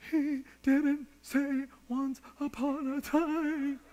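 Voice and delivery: high, drawn-out mock wail; imitated tearful child's whine; crying four-year-old. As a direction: wail it slowly like a bawling little boy. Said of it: "he can't even voice it".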